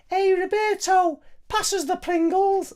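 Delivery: put-on very high, drawn-out falsetto like an elderly woman; badly faked Liverpudlian in a squeaky-high slow voice; bad Steven Gerrard. Recalling a Pringles advert for viewers, hopelessly high-pitched footballer impression. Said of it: "Steven Gerrard opens up and goes".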